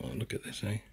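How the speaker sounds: hushed whisper. Real, not acted. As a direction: whisper it under your breath. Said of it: "Why are we whispering?".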